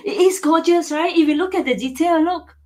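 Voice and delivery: high, loud gushing over the detail; excited praise. Real, not acted. Seller to viewers right after laughing, excited. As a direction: still giddy, praising fast.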